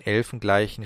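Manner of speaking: ordinary delivery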